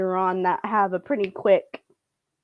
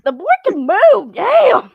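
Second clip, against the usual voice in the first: much higher and louder amazed shout; amazed exclaim; 10 semitones higher, 9 dB louder, slower than usual. Retold or imitated. Watching Elliot spring up, loud amazed exclaim. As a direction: marvel at how fast he moved. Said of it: "I'm like".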